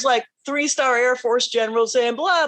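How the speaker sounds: ordinary delivery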